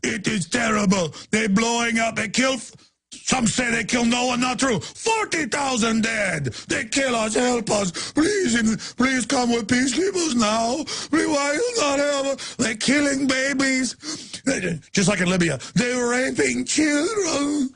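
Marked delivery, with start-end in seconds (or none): 0.0-14.0 mocking put-on Arabic accent, rising higher and more pleading toward shouts; fake Arabic accent. Host mocking a CNN guest in a fake Arabic accent begging for peacekeepers. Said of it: "One day he's like". 15.7-17.7 mocking put-on Arabic accent, rising higher and more pleading toward shouts; fake Arabic accent. Host mocking a CNN guest in a fake Arabic accent begging for peacekeepers.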